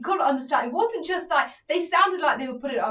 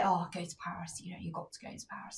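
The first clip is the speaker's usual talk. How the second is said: much softer, quicker, near-breathless mockery; mocking people's advice; 6 semitones lower, 13 dB quieter, faster than usual. Mocking people who push Paris: soft, fast, laughing mimicry. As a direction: rattle off what everyone tells you, half-laughing. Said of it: "People say".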